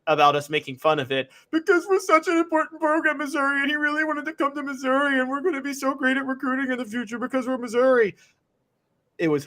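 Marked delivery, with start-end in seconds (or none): 1.5-8.1 high-pitched mocking whine; whiny voice. Mocking Missouri fans crying about being made fun of, whiny. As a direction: whine it like a sore fan, pitched way up. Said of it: "That was a whiny voice".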